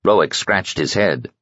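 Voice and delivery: ordinary delivery, nothing marked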